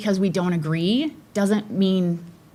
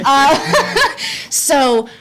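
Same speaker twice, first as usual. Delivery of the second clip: embarrassed laugh; 8 semitones higher, 7 dB louder than usual. Real, not acted. Laughs after fumbling Spanish name.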